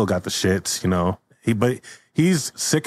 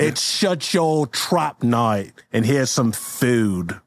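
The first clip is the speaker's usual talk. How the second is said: slow, higher put-on guard voice; scolding jail guard; 4 semitones higher, slower than usual. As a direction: lay down the rules slowly. Acted guard scolds prisoner.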